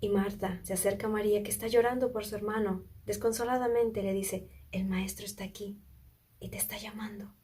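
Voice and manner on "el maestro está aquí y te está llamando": hushed whisper, fading to barely audible; secret whisper. Acted. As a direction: whisper the news to a grieving sister. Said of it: "diciéndole en secreto". (susurro confidencial que se apaga)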